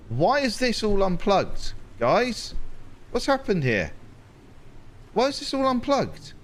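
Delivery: high, stretched-out exasperation; exasperated. Real, not acted. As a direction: whine at the unplugged cables. Exasperated about unplugged machines.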